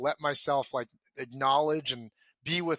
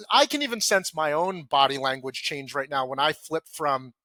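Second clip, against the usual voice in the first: ordinary talk, nothing marked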